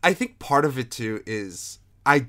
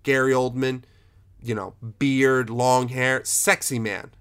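ordinary talk

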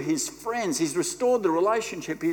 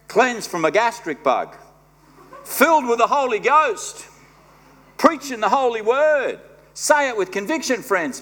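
louder, hammed-up return announcement; mock triumphant; 4 semitones higher, 7 dB louder than usual. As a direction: announce yourself like a showman. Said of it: "jokey self-announcement".

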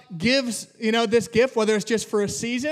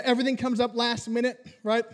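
normal talking voice

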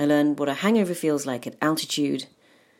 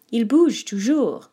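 patient French roommate explaining; 7 semitones higher, 6 dB louder than usual. Retold or imitated. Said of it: "She gesticulated to show me what she meant".

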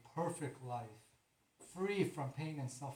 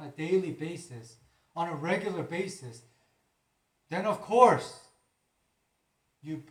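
loud, impassioned sermon, higher and louder; preaching; 4 semitones higher, 9 dB louder than usual. Acted. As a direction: preach it at full force. Preaching at full force.

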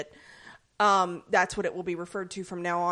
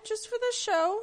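much higher, playful mock sing-song; 12 semitones higher than usual. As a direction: echo yourself in a silly high voice. Repeats phrase in mock high voice.

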